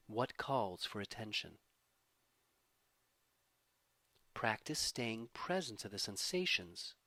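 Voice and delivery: calm, measured voice easing down at phrase ends; guided meditation. Acted. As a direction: gently lead a body scan, unhurried, letting each phrase settle. Leads a body-scan meditation.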